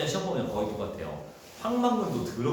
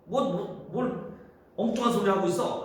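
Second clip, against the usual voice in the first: higher-pitched acted annoyance; irritated retort; 8 semitones higher than usual. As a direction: stammer into an indignant brush-off. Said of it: "이 장은 왜 이렇게 말한 거야?".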